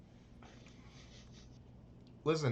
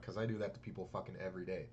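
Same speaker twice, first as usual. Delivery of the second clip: muttered inner thought, lower and softer; mock inner voice, lower and softer; 8 semitones lower, 9 dB quieter, faster than usual. Rude people's secret thought about others, low and soft. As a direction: say it under your breath, low. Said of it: "in their head, they're like".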